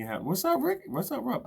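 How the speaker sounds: high, loud hello; excited shout-out. Real, not acted. Host hails viewers Rick and Rob on the stream, high and loud. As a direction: hail each friend as he arrives. Greeting viewers joining the live.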